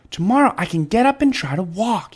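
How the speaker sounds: lightly voiced eager boy. A narrator's reading.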